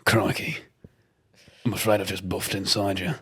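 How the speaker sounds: put-on soft, hushed British accent a la Statham; lightly done British Statham; Jason Statham impression. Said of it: "Jason Statham talking dirty".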